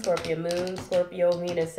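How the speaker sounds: ordinary delivery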